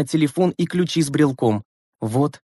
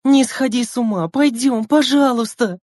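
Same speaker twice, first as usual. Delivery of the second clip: tearful pleading; 10 semitones higher than usual. Acted. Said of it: "закричала Лена со слезами в голосе".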